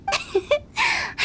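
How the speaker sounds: a high, rising giggle; amused giggle. Acted. Director giggling at being blamed for no help, amused. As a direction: chuckle off the jab.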